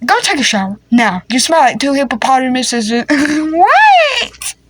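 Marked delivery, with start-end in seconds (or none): 2.9-4.5 high laughing shriek. Real, not acted. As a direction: shriek through a laughing fit. Laughing fit.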